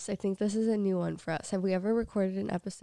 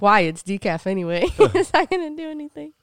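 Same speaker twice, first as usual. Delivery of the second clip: laughing retort; 4 semitones higher, 10 dB louder than usual. Retold or imitated. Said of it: "I was like".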